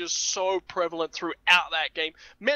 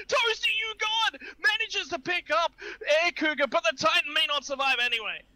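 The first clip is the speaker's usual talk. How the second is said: commentary climbing steadily higher to a cried-out peak; excited play-by-play; 7 semitones higher than usual. Acted. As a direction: build urgency into a high shout. Live call of decisive fight.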